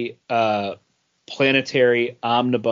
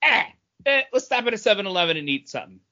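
higher, hammed-up eager hero chatter; mock hyped heroes; 7 semitones higher, faster than usual. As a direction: parody the characters' gung-ho lines. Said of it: "and it was like".

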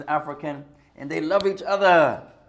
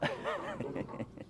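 short laugh; 14 dB quieter than usual. Real, not acted. Laugh after rock paper scissors.

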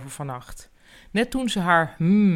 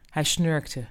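plain reading voice

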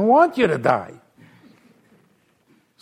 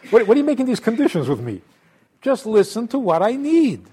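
fast and higher exasperation; exasperated God; 7 semitones higher, faster than usual. Acted. God exasperated with conditions, fast and high. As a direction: hurry the scolding.

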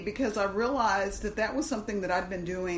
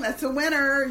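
excited announcer; 7 semitones higher, 6 dB louder than usual. Pretend. Game show host call.

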